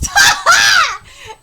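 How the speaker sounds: high, loud burst of laughter; sudden shrieking laugh. Real, not acted. Laughs at the insulting dialogue.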